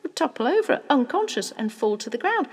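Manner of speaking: animated father spinning a poaching plan; excited scheming father. Acted. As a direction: lay out the scheme with growing relish.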